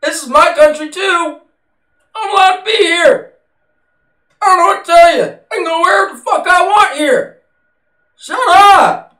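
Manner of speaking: high, loud, defiant comeback; snapping back at the shrieking woman, loud; heated retort. Retold or imitated. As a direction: fire back at her, exasperated. His retorted reply to screaming lady.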